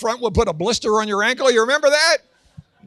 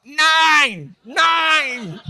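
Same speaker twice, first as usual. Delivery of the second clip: high, loud, drawn-out shout; yelled over top; 8 semitones higher, 6 dB louder than usual. Acted. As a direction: holler it across the store aisles. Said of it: "I yelled over top".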